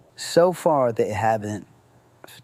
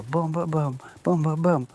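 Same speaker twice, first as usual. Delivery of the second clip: imitated door banging; 6 semitones higher than usual. Cops banging at front door.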